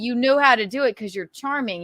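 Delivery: ordinary talk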